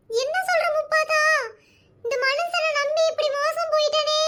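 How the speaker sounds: hammed-up weeping over a cheating husband; mock crying. Wife wailing to neighbour about husband's betrayal, mock tearful. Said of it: "அழுகாத உமா".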